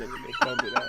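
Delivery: squeaky high laughing protest. Real, not acted. Joking denial about mixed race.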